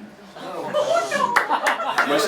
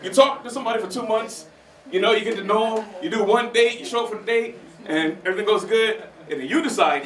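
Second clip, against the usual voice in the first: ordinary talk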